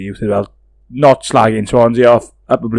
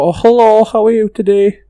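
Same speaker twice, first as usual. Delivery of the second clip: high-pitched, lilting Swansea greeting; Swansea accent; 9 semitones higher than usual. Shown as a demonstration. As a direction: put on a high West Walian Swansea accent. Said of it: "Do the Swansea accent then".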